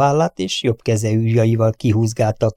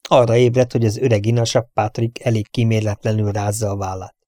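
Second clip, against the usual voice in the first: ordinary delivery, nothing marked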